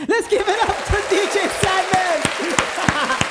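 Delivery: high, slow hype announcement; announcer. Acted. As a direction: bring the guest on stage. Announcing the guest.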